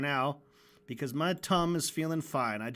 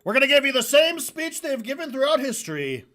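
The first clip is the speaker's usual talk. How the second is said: higher and louder, piping up; 9 semitones higher, 9 dB louder than usual. Retold or imitated. Animator imitating Alex's speech line, piping up high. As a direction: suddenly pipe up high and loud. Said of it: "he pipes up and goes".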